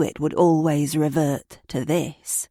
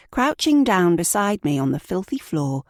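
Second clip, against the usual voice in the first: plain reading voice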